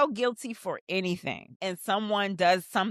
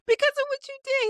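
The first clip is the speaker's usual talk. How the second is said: mock crying; faster than usual. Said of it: "she starts crying in this moment".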